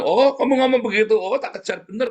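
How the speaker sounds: high mocking devil voice; devil's taunt. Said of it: "kata iblis".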